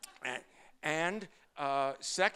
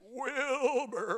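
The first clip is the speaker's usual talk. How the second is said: high, far-off horse voice calling out the name; whinny the name like a talking horse; Mister Ed; 10 semitones higher than usual. Retold or imitated. Rabbi lecturing an audience, doing Mister Ed calling Wilbur, high and horsey. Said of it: "I do very few impressions, but here we go".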